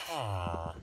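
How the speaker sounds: normal talking voice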